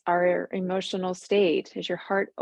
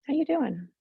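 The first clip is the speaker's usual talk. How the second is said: higher-pitched gentle greeting, sliding down; tender check-in; 7 semitones higher than usual. Acted. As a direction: ask it fondly, starting high. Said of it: "check in with her and say".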